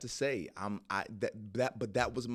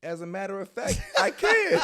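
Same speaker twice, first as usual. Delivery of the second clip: put-on loud, high Jamaican accent; playful, booming Jamaican accent; 14 dB louder than usual. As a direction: answer proudly in a hammed-up Jamaican accent. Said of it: "can you have a Jamaican accent".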